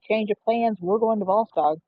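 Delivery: ordinary delivery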